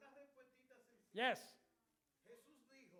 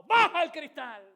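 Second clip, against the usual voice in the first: loud, slow urgent command; shouted order; 8 semitones higher, 20 dB louder, slower than usual. Retold or imitated. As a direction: bark the order at the boy. Urgent order to son in car.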